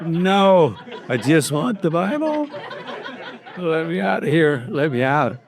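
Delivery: high, laughing plea to be let out. Retold or imitated. Laughing as he retells the plea for the Bible.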